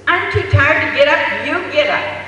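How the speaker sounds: a worn-out mom snapping at her husband; tired annoyed mother. Retold or imitated.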